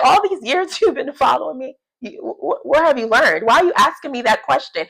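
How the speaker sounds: high, teasing and half laughing; snarky, laughing rebuttal. Retold or imitated. Jesus teasing a disciple, laughing and snarky. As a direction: laugh through a cheeky comeback. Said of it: "He was like".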